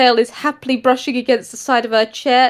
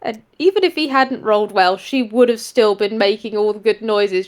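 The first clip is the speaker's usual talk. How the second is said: ordinary talk, nothing marked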